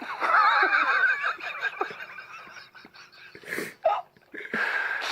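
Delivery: loud, high, helpless laughter; roaring laughter. Real, not acted. Host cracking up at a fan's cruel comment, roaring laughter. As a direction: lose it completely at the joke.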